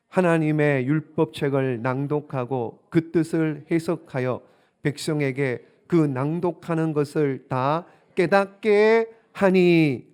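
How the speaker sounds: slow, measured Bible reading; drawn-out congregational scripture reading. Acted. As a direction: read the verse out deliberately, stretching the final phrase. Reads scripture aloud to congregation.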